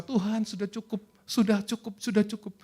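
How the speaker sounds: a hint of a worn-out believer begging; weary plea. Believer tired of God's process, lightly voiced plea. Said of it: "kita merasa".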